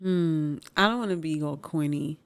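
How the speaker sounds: normal talking voice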